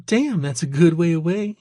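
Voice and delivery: high-pitched waitress from Georgia; friendly, lilting high Georgia accent. A narrator's reading. Waitress chatting about hometowns, Georgia accent. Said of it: "an accent that was distinctly from Georgia".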